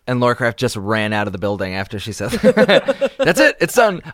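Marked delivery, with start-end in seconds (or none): 2.3-4.0 high, loud laughing. Real, not acted. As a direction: laugh through the words. Joking guest fled, laughing.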